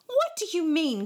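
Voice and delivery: high, loud question; distressed cry. A narrator's reading. David questioning the boys' cruel game, crying out. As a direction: cry out in disbelief. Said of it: "cried David".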